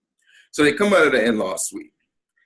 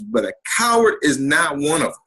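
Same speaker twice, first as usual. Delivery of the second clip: normal talking voice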